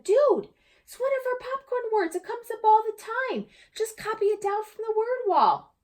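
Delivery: a classmate brushing it off, dude; boy waving at the word wall, high and offhand; casual boy Brandon. Acted. Brandon voiced.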